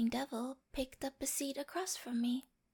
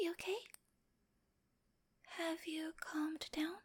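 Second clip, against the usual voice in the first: soft reassurance; 2 semitones higher, 7 dB quieter than usual. Acted. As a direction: gently check that they have calmed. Checking on frightened partner.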